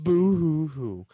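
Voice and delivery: slow, hammed-up boo-hoo sobbing; fake crying. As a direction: blubber it like a fake cry. Mock weeping for sad news.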